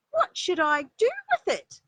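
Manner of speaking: high, loud little bunny voice; a small child bursting to show off her tooth; excited little rabbit. Acted. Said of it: "asked Little Rabbit".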